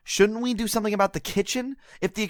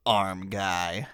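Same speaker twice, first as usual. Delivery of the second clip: mocking jab; 14 semitones lower, 6 dB quieter than usual.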